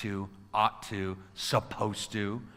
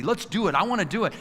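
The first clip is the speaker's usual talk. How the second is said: higher, upbeat and willing; 1 eager quote; 7 semitones higher, 4 dB louder than usual. Retold or imitated. Speaker voicing someone eager to act, bright and higher. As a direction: say it like you truly want to.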